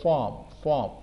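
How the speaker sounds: فارم with an open a-like vowel. Shown as a demonstration. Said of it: "او کو اے کی طرح پروناؤنس کر رہے ہیں".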